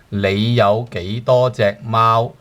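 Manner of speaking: 你有幾多隻貓 said slowly, word by word; slow teacher demo; slow model sentence. Shown as a demonstration. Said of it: "And then cat".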